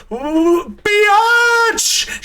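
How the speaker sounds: high, loud, drawn-out screech; full-volume imitated kid yell, pitch soaring; child's scream. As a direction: scream it out like an excited five-year-old. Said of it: "She just screamed it".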